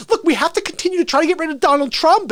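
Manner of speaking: high, fast, loud mock politician; Klobuchar. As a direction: rattle it out like a strident campaigner. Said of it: "Every time she's like".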